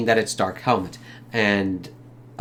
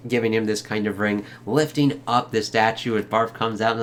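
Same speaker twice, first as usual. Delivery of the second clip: ordinary talk, nothing marked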